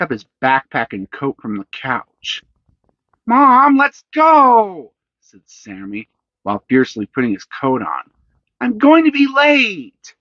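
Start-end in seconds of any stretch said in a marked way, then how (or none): 3.2-10.1 put-on high, loud, hurried kid; a boy rushing his mom; impatient boy. A narrator's reading.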